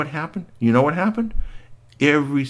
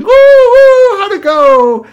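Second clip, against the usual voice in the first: princesses whooping; 13 dB louder, slower than usual. Acted. Princesses whistling and cheering.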